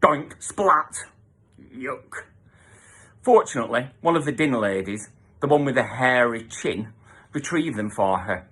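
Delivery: usual speaking voice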